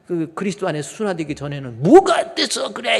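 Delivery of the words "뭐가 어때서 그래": much higher, loud snapping retort; angry retort. Retold or imitated. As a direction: bite back at the wife. Old angry retort to wife. (아내에게 버럭 화내며 대드는 말투)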